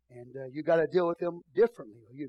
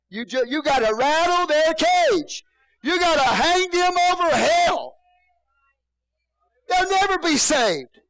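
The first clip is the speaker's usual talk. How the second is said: slow, much higher and louder preaching roar; hellfire shout; 12 semitones higher, 12 dB louder, slower than usual. Acted. Pastor preaching to hardened sinners, slow booming shout. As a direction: hang them over hell with your voice.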